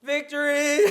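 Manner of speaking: loud laughing victory cry; triumphant. Real, not acted. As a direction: crow the win, laughing. Winning the challenge.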